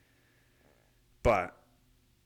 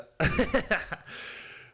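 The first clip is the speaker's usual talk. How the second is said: very high burst of giggles; giggling laugh; 4 dB louder than usual. Real, not acted. Laughing at his own try at "February", giggly. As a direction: crack up at your own attempt.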